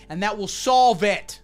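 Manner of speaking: usual speaking voice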